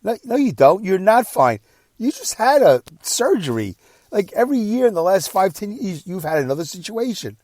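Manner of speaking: high, insistent arguing back; exasperated contradiction. Retold or imitated. Son insisting to his father he is not fine, exasperated. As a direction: push back hard, as in a retold quarrel.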